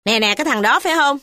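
put-on high, fast old woman's voice; sharp old lady. A narrator's reading. Mother asking the constable, fast high old woman. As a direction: jab the question at him. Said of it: "Bà cai ngó ba Cam mà hỏi".

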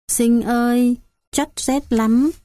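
put-on high, slow, weak little child's voice calling her brother; a feverish toddler whimpering for her big brother; sick little girl. Dying little sister tells brother Sinh she is cold, high and weak.

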